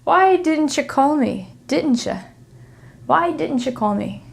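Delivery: "didn't you" blended into "didncha"; connected T plus Y. Shown as a demonstration. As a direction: say "didn't you" with a small ch in the middle. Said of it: "like, a small C H".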